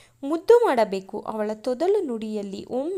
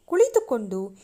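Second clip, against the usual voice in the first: usual speaking voice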